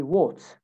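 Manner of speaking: "what" with a rounded O vowel; "what" drawn out with a British O sound. Shown as a demonstration. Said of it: "in British accent is actually a, an O sound".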